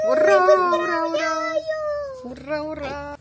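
high, loud gleeful cheer; excited cheering. Real, not acted. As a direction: cheer ura with squealing joy. Cheering baby's new tooth.

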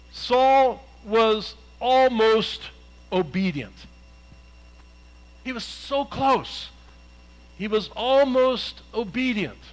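slow, drawn-out Saul was almost; emphatic almost. Real, not acted. Preacher driving home Saul's near-obedience, slow and emphatic. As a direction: lean hard on almost, lingering. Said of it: "preacher stressing almost".

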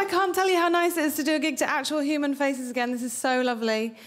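normal talking voice

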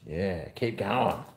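ordinary talk, nothing marked

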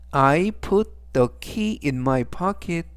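I put the key in my pocket, very slow and deliberate; slow model sentence. Shown as a demonstration. Teacher reading example sentence slowly for class. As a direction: read it word by word for learners. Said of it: "활용 문장 보시겠습니다".

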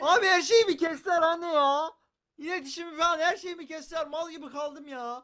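high, worked-up protest; exasperated complaint. Real, not acted. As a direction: complain in real frustration, pitch way up. Bağlantısı kesildi, annesine isyan ediyor. (isyan ederek, tiz sesle yakınarak)

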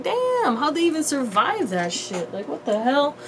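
imitated amazement. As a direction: react like a stunned listener, pitch jumping up. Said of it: "And I'm like".

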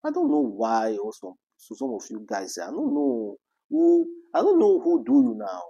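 usual speaking voice

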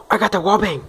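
excited. Real, not acted. Celebrating a lucky kill.

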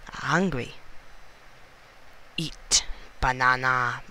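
usual speaking voice

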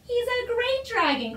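high, delighted exclamation; crying out. A narrator's reading. Father praising the dragon, jubilant cry. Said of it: "cried his father".

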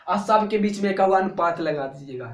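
ordinary talk, nothing marked

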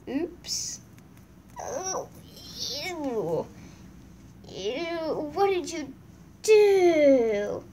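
grossed out at a stink; ew, recoil from the smell; disgusted by smell. Real, not acted. Smelling horse poop.

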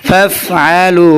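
ফাফআলু with the আলিফ held long; slow drawn-out word; stretched long vowel. Shown as a demonstration. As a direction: hold the alif for a full count. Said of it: "এক আলিফ টান হবে".

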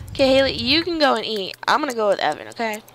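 normal talking voice

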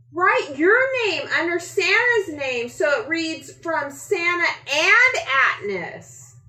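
put-on a boy beaming as he asks; with a grin. A narrator's reading. The boy handing Atnis a pen, high and grinning. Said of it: "he said with a grin".

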